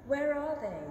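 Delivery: ordinary talk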